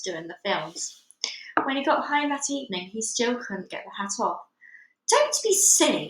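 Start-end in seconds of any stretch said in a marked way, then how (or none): 5.1-6.1 high, loud scolding wife voice; wife dismissing husband, high and loud. Acted. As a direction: brush him off sharply, as the nagging wife. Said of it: "his wife said".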